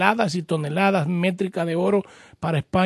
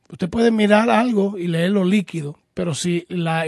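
ordinary delivery

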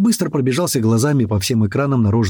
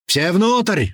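raised, higher command shout; 7 semitones higher than usual. A narrator's reading. Commander ordering everyone inside, shouting. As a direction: call the order out urgently. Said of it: "вновь закричал Туман".

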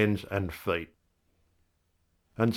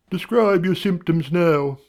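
higher, louder doctor voice; 9 semitones higher, 3 dB louder than usual. A narrator's reading. Doctor questioning the patient, higher voice. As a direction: play the brisk doctor, higher.

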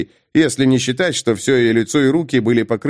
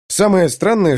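ordinary delivery

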